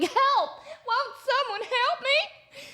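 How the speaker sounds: high, thin damsel cry; hammed-up distress call; mock plea for help. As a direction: act out a helpless call, drawn out at the end. Acts out calling for help.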